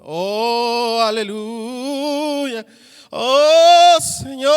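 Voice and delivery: high, drawn-out pious exclamation; mock churchy voice. Mocking how churchgoers talk in church, hammed-up pious voice. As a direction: parody a showy churchgoer's holy voice. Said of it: "su forma de hablar cambia cuando están en la iglesia".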